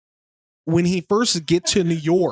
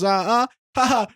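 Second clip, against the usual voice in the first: higher-pitched test noises, ah and ha; Borat's mic test; 7 semitones higher than usual. Acted. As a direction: try out a microphone with short high sounds. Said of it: "he tests, he goes".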